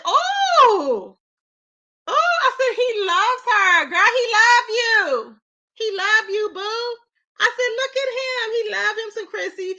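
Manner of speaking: high, slow squeals with a laugh; hammed-up delighted squeal; gushing. Gushing over the boyfriend.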